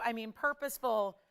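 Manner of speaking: ordinary delivery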